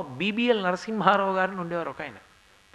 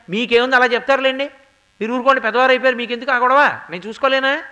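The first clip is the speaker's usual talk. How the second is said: put-on louder, higher, brushing the old man off; defiant dismissive son; 4 semitones higher, 7 dB louder, faster than usual. Defiant son waving off his aged father, louder and dismissive. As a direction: retort like an impatient grown son.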